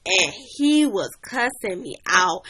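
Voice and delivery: ordinary talk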